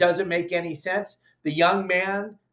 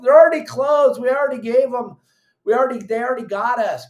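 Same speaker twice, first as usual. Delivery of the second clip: angry shout; 8 semitones higher, 7 dB louder, faster than usual. Real, not acted. Angry rant at pro-closure posters.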